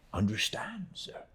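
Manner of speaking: ordinary delivery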